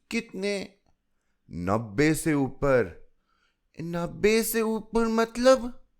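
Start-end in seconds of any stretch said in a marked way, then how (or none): 0.1-0.7 high, eager little question; curious child. A narrator's reading. Chilki asks how old, high child voice. 3.8-5.9 put-on high, slow puzzled little girl; curious child. A narrator's reading. Chilki asks what over ninety means, high child voice.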